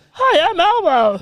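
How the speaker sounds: high, slow puppet greeting; Elmo. Acted. Puppet introducing himself on the hotline, high and drawn-out. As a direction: squeeze up into a childlike monster voice. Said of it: "is this Elmo?".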